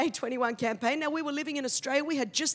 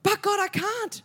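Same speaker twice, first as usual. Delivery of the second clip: pleading with God; 6 semitones higher, 5 dB louder, slower than usual. Retold or imitated. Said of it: "So I said".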